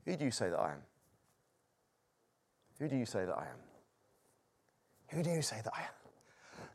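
low, hushed question; soft, searching delivery; quiet questioning. Retold or imitated. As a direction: ask softly and gravely. Acts Jesus quietly asking his disciples.

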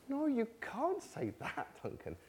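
high, soft, drawn-out protest; mock objection. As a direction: start high and quiet, then sink. Voices an imagined objector's protest.

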